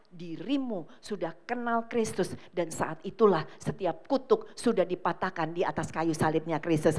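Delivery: normal talking voice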